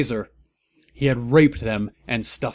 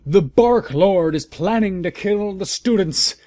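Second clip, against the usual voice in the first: higher, louder yell; 7 semitones higher, 4 dB louder than usual. A narrator's reading. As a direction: Fudge yelling a warning at Dumbledore, higher yell. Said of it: "yelled Cornelia Fudge".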